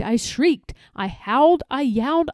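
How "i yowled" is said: ordinary delivery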